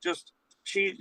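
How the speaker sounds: ordinary delivery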